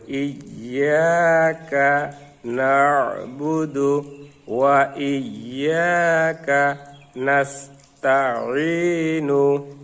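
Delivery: very slow, drawn-out Quran recitation; slow joined recitation. Shown as a demonstration. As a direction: join the whole verse, stretching the long vowels. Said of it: "আস্তে আস্তে করে". (আস্তে আস্তে টেনে তেলাওয়াত)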